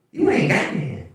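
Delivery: high, lightly voiced disbelieving woman; mock woman caller. Woman caller doubting he has no name. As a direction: echo it like a baffled caller.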